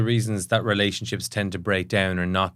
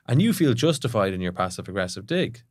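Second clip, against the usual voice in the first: normal talking voice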